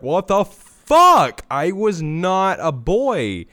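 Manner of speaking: loud, slow, stunned outburst; outraged shout. Retold or imitated. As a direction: blurt it out in disbelief. Outraged quote of own reaction.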